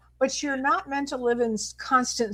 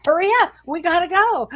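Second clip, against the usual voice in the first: higher, louder mock rush; hurrying urgently; 6 semitones higher, 3 dB louder than usual. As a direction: nag the child to hurry up. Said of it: "I meant".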